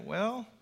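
soft, rising "Well"; "Well" softly turned into a question. Shown as a demonstration. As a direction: lift "Well" gently like an attentive listener. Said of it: "they're being attentive to the preaching".

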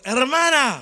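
loud solemn churchy address; preacher voice. Retold or imitated. Calling her sister in a loud preacher tone. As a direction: switch into the holy voice. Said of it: "le sale esa otra voz".

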